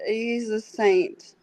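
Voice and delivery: normal talking voice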